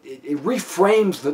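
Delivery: ordinary delivery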